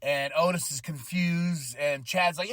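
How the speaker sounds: ordinary talk, nothing marked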